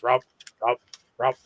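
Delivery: low grunting rump sounds; Homer Simpson noise. Retold or imitated. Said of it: "I'll make the Homer Simpson noise".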